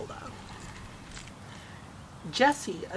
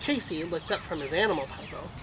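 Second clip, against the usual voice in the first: ordinary delivery, nothing marked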